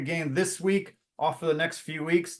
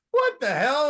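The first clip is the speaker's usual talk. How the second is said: retold crowd shouting out in surprise, pitched high; a mock shocked "what the hell", high; startled outcry; 8 semitones higher than usual. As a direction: crowd at a comedy show cries out in surprise. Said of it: "everybody looks over and goes".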